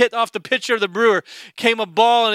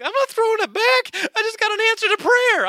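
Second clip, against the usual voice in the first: much higher, squeaky protest; indignant refusal; 12 semitones higher than usual. Retold or imitated. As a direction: hold on to the ball in mock outrage. Refusing to return the ball.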